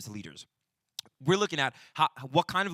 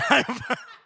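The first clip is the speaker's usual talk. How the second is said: short, high burst of laughter; amused laugh; 13 semitones higher, 10 dB louder than usual. Real, not acted. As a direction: burst out laughing at your own retort. Laughs after age retort.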